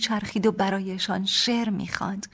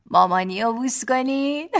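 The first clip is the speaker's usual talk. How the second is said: put-on higher, louder cooing to the kids; baby talk; 6 semitones higher, 7 dB louder than usual. Father urging the children to kiss mom, baby talk. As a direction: coax the toddlers sweetly.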